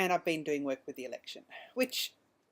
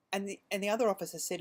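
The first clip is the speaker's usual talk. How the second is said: ordinary talk, nothing marked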